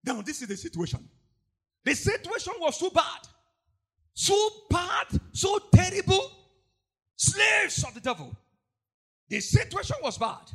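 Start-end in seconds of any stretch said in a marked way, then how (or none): 1.8-10.5 higher, louder emphatic preaching; fiery preaching. Real, not acted. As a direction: press the point with fire.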